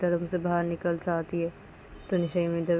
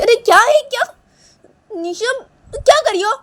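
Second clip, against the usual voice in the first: very high, very loud protest; outraged shout; 15 dB louder than usual. Acted. Manisha as baby is taken, shouting in outrage. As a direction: cry out at full volume, pitched way up.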